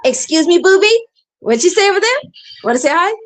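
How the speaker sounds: high, loud singsong calling; playful coaxing. Real, not acted. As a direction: coo loudly to a little companion. Calling playfully to someone off-camera.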